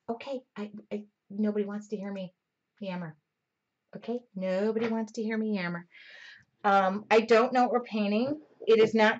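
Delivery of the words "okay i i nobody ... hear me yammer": soft, self-deprecating murmur; talking quieter. Real, not acted. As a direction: keep the voice well down. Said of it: "I will talk quieter".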